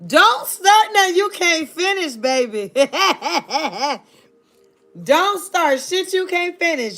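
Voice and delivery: ordinary delivery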